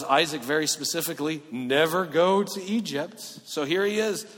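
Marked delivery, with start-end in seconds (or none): 1.5-3.2 slow, loud, every word weighted; stern command. Acted. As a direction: lay down the law, slowly. God commanding Isaac.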